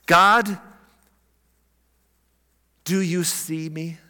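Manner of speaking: slow, halting plea to God; pleading prayer. Acted. Nathanael praying to God under the tree, pleading slowly. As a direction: pray it slowly, searching for an answer.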